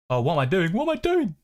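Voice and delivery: sudden squeaky high panic, jumping way up; odd panicked voice. Real, not acted. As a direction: yelp it out in a strange high voice. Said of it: "I don't know why I spoke like that".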